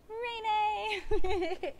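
high, drawn-out happy call of a name; giddy, cutesy greeting; cutesy excitement. Real, not acted. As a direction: squeal the friend's name in delight. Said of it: "I get so excited".

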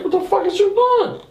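very high, incredulous outcry; startled quote, voice shot upward; alarmed retold challenge. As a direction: question him in high-pitched panic. Confronting man with possible gun.

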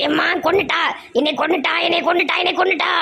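screaming she is being killed; wailing. Acted. Mother-in-law being beaten by her daughter-in-law, rising wail.

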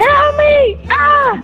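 high, loud panicked cry; frantic game-panic yell; yelling for help. Real, not acted. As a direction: scream for help as you're dragged. Character hanged, calls for help.

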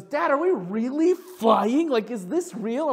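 high, loud, stretched-out wonder; imitated child's amazement. Daughter asking dad on the Falcon ride, imitated amazement. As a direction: ask like an astounded little girl. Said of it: "in this awe and this amazement".

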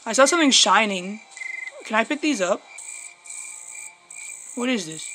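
ordinary talk, nothing marked

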